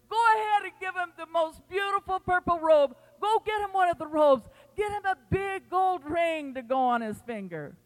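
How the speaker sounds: loud, high joyful call to the servants; retold yell. Father yells to servants to bring the robe, loud and high. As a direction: shout the orders out with joy. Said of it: "he yelled back to the servants".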